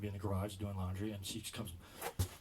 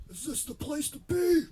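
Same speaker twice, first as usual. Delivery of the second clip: much higher, louder, slow goofy voice; dopey customer voice; 11 dB louder, slower than usual. Retold or imitated. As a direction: stretch it out like a clueless customer. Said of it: "He goes".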